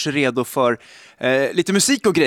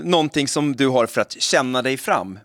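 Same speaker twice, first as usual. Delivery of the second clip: ordinary delivery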